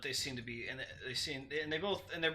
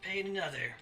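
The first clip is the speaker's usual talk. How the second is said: louder, threatening gruff halfling voice; gruff menace, pushed out louder; 3 semitones higher, 8 dB louder than usual. Acted. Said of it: "in a gruff voice".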